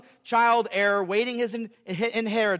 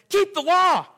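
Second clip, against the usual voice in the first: loud, higher stern command; law voice; 9 semitones higher, 7 dB louder than usual. Pretend. The law ordering the sinner, loud and stern. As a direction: bark it as the law. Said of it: "The law says".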